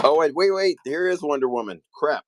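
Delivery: real startled outburst; sudden surprise. Real, not acted. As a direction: caught off guard mid-auction. Suddenly spots Wonder Woman item.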